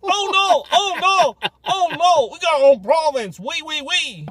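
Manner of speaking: high, loud hammed-up French Canadian whine; mock French Canadian. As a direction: parody a Quebecer protesting. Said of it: "mocking French Canadians".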